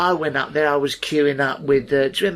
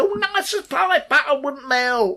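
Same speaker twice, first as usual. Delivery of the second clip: much higher, sugary TV presenter voice; sweet-as-pie presenter; 10 semitones higher than usual. Retold or imitated. Fiona Bruce sweetly cooing at the Antiques Roadshow queue. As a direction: coo it like butter wouldn't melt. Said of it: "Fiona Bruce said".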